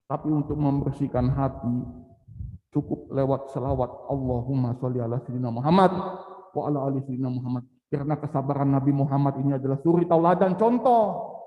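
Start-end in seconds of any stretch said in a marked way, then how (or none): 4.1-7.7 low recited prayer, the second half rushed; recited salawat. Shown as a demonstration. Preacher showing how salawat cleans the heart, low recitation.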